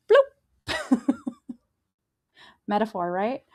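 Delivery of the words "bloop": playful high-pitched plop sound; high bloop. Retold or imitated. God drops Jonah into the whale, playful bloop. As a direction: make a tiny high bloop.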